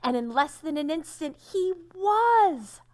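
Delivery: building high and loud to a cry; dramatic reveal. A narrator's reading. Reading the transformation to children, building to a joyful cry. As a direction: let the magic burst out.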